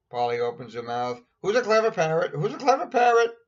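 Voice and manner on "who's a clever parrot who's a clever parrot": high, loud squawky parrot. Acted. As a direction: screech it like a proud parrot. Parrot forgets the list.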